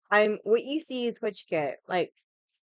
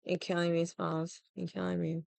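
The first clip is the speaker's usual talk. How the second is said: quiet aside; 3 semitones lower, 7 dB quieter than usual. Retold or imitated. Movie quote muttered aside.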